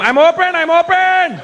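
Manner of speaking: high, urgent call for the pass; mock player shouting for the ball. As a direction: yell that you're open.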